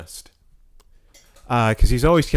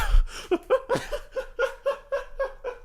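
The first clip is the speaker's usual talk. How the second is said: squealing laughter; laughing helplessly; high breathless laugh; 5 dB quieter than usual. Real, not acted.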